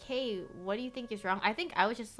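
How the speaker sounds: normal talking voice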